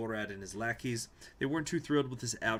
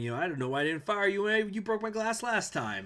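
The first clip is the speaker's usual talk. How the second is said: angry boss; 9 semitones higher, 4 dB louder than usual. Retold or imitated. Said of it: "his boss going".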